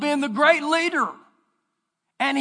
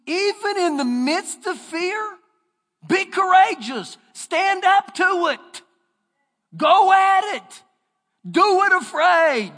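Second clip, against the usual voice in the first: preaching at full force; 5 semitones higher, slower than usual. Real, not acted. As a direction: drive it home to the congregation. Preaching climax, shouted.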